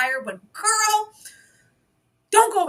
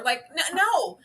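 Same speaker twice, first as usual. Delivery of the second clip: ordinary talk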